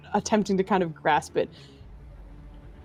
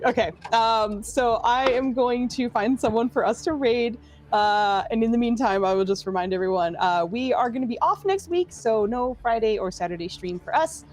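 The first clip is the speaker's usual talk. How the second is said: usual speaking voice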